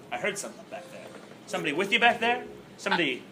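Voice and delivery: hushed. Acted. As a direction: lean in and ask quietly. Detective speaking hushed, suspecting listener.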